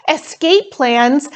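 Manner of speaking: alarmed. A narrator's reading. Jessica's alarmed thought.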